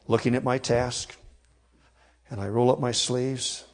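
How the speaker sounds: ordinary delivery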